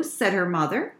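ordinary delivery

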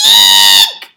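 very high, loud shrieked eek; terrified eek. Acted. Lion meeting the mouse and shrieking in terror. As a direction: shriek in fright. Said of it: "let out an".

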